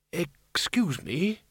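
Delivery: put-on old Windle. A narrator's reading. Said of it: "said Windle".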